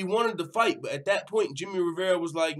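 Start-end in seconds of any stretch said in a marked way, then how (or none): none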